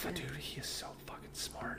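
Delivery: intense breathy whisper; whispered emphasis. Real, not acted. Dad boasting about his son, emphatic whisper. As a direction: whisper it with conviction.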